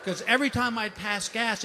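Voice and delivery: usual speaking voice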